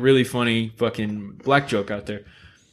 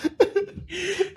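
real, high wheezing laugh; high burst of laughter; 5 dB quieter than usual. Real, not acted. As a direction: crack up at the punchline. Laughing at own punchline.

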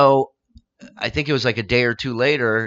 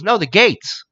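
higher, louder punchline; punchline delivery; 10 semitones higher, 4 dB louder, slower than usual. Retold or imitated. Comedian hitting joke's punchline, high and loud.

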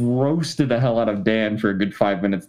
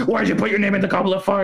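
higher and fast, a mock furious rush; frantic movie Dumbledore; 9 semitones higher, much faster than usual. Movie Dumbledore shoving Harry against a wall, hammed-up frantic outburst. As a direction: fire it off in a panic, like grabbing Harry. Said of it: "he's like".

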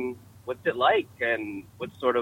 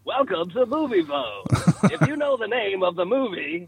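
high, booming-style automated greeting impression; Movie Phone; 7 semitones higher than usual. Friend jokes back as the movie hotline, mock announcer voice. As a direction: put on a hammed-up phone-line announcer voice. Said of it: "You sounded like movie phone".

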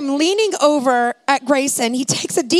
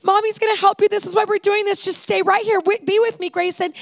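put-on high, tender coaxing to her hurt little boy; tender mother; 7 semitones higher than usual. Mother talking her injured son through the scan, tender and high. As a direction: soothe him like a scared mom keeping her baby awake.

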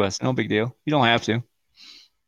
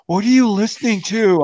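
higher-pitched mock wife's demand; annoyed wife; 8 semitones higher, 3 dB louder than usual. Wife woken by the show asks husband, annoyed. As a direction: imitate a wife's irritated question.

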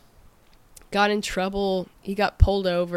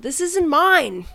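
indignant chef; 10 semitones higher, 7 dB louder, slower than usual. Retold or imitated. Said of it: "He said".

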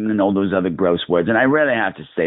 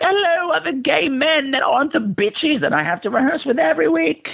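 high mock greeting. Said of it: "I'm like".

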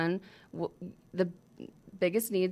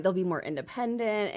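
usual speaking voice

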